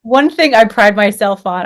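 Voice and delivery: usual speaking voice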